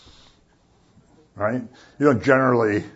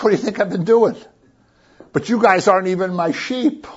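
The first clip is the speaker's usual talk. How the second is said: higher-pitched, mildly exasperated comeback; exasperated retort; 6 semitones higher than usual. Retold or imitated. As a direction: act out an exasperated answer to doubters. Jesus voiced answering his doubters.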